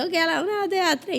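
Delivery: high, tense counting; whisper-count yourself into bravery, voice up high; scared girl counts to three under the covers, high and tense; nervous countdown. Retold or imitated. Child counting up courage.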